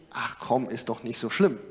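low, offhand brush-off, lightly voiced; mock dismissive comfort. Preacher mimicking hollow comfort, mock offhand tone. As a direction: shrug it off like it's nothing.

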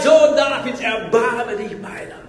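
high cry, falling away at the end; imitated cry for mercy. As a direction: call out a drawn-out plea, then ease off. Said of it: "Und er schreit".

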